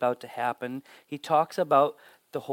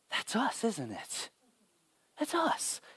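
higher, softer hint of a wondering wife; lightly voiced wife; 6 semitones higher, 8 dB quieter, slower than usual. Wife realizing her husband's story is theirs, gently higher. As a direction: let her voice lift in fragile recognition.

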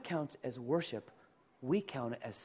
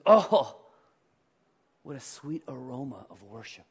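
voicing God; 3 semitones lower, 8 dB louder than usual. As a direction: burst out with joy, then melt into softness. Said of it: "he goes".

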